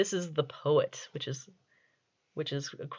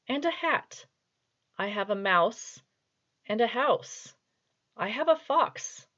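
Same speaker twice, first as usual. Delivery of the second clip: mock-solemn, higher poem recitation, each line set apart by pauses; very serious reading; 3 semitones higher than usual. Shown as a demonstration. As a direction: read a child's rhyme as if it were grand poetry. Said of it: "I'm going to do this as a very serious reading".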